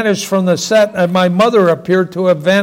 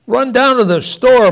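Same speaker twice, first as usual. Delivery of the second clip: much higher, louder outraged cry; thundered; 7 semitones higher, 3 dB louder than usual. Acted. Father thundering at mother in disbelief, higher and louder. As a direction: bellow it in disbelief at the order. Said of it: "my father thundered".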